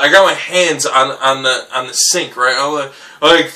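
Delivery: ordinary delivery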